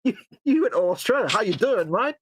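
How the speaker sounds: a hint of Australian, high and bright; playful Aussie greeting; lightly put-on Australian accent; mock Australian accent. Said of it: "You went Australian then".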